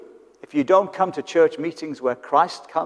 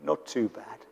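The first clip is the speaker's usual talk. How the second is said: much softer, lower, deflated British grumble; preacher mimicking a downbeat Brit, low and soft; gloomy Brit; 3 semitones lower, 11 dB quieter than usual. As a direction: shrug it off like a glum Englishman. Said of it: "you ask a Brit and they say".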